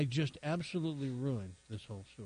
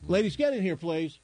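loud call across the room, pitched up; 6 semitones higher, 7 dB louder than usual. Real, not acted. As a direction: shout them into the studio. Calling guests into studio.

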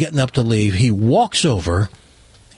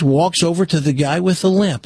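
ordinary talk, nothing marked

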